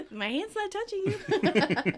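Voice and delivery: slow, high teasing; drawn-out childish needling; mock taunt. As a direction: taunt playfully through a laugh. Teasing like a kid, childish antics.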